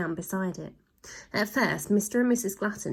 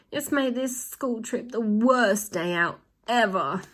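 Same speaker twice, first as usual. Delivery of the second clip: yowling; 3 semitones higher, slower than usual. A narrator's reading. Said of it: "yowled a fifth".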